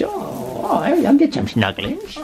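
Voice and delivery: high-pitched cutesy voice for the puppy; squeaky little dog talk; puppy's voice. Retold or imitated. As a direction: speak as a puppy wanting snuggles. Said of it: "She says".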